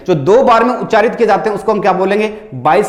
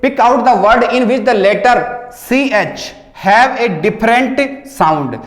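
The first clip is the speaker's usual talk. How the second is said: slow reading aloud; 3 semitones higher, much slower than usual. Shown as a demonstration. Teacher reading the exam question to students, slow. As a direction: question read out slowly. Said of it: "आगे देखिए".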